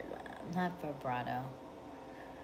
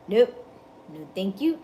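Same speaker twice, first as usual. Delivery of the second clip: a sudden loud, playful nope; 6 dB louder than usual. Acted. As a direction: throw out the nope loudly, like slamming a door. Emphatic rejection of red flags.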